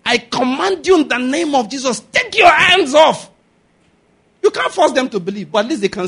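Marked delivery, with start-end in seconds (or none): 0.0-3.3 raised, higher rebuke building to a shout; commanding prayer. Shown as a demonstration. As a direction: command the devil out, rising to a shout.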